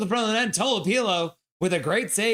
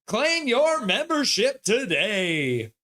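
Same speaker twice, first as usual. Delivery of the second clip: 1 mock ad voice; 6 semitones higher, much slower than usual. Mimicking a promo line.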